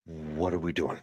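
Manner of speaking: low, very soft question; hushed disbelief. Real, not acted. Commentator questioning Russia in Cuba, hushed disbelief. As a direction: ask it under your breath. Baffled aside, then asked again.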